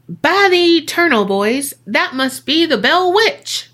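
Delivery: high, loud exclamation of a quoted general; Andrew Jackson. Retold or imitated. General quoted exclaiming to his men at the stuck wagon, declaiming. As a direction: declaim it like an astonished commander. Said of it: "Jackson was quoted saying".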